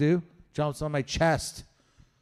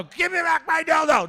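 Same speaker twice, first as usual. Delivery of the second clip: mock demand; 11 semitones higher, 5 dB louder than usual. Acted demand at ex's door.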